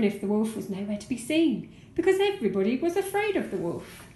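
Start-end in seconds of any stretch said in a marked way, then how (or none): none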